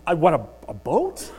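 baffled, voice rising on boat; puzzled question. Acted. Winner mishears the caller, puzzled question. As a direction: query it in confused disbelief.

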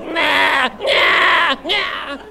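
high, loud imitation bleating; loud goat bleat. Goat refuses to be pulled along, loud imitated bleats.

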